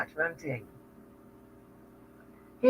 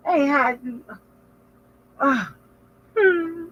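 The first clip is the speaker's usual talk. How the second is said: imitated friend; 10 semitones higher than usual. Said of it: "You're like".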